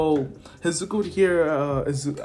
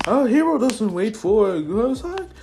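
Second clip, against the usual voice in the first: high, loud announcer shout; hyped-up shouting, climbing higher; Professor Mike; 13 semitones higher, 5 dB louder than usual. Acted. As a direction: yell the start signal at a high pitch. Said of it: "until Professor Mike shouts".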